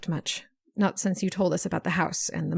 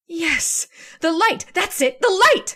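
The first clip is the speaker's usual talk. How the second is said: high, loud eureka; seizing on the plan in triumph; sudden excitement; 8 semitones higher, 5 dB louder than usual. Acted. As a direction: burst out with the idea. Pam hits on the plan.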